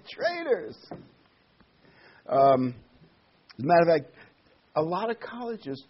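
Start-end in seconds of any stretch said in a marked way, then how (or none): 0.1-0.8 exclaimed. Real, not acted. Mock exclaimed repetition.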